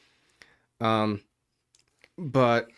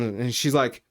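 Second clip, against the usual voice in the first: normal talking voice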